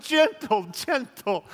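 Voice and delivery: high pleading cry of Gentle fading off. Retold or imitated. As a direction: beg them to go easy, voice jumping high. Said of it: "I'm like".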